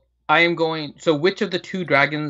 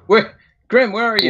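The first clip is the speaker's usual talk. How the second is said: calling out; 7 semitones higher, 6 dB louder than usual. Real, not acted. Calling out to missing player.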